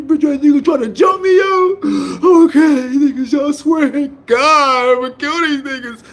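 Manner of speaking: tearful high-pitched rant, half sobbing; choked-up angry crying. Retold or imitated. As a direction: rant through tears, voice cracking high. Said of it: "So I'm crying".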